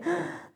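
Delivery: sharp, put-on gasp; hammed-up intake of breath; dramatic gasp. Mimicking someone going through the dark night, dramatic gasp.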